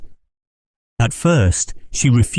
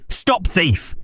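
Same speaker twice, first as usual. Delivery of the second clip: cry stop thief; 10 semitones higher, 4 dB quieter than usual. Acted. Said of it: "cried Huckster".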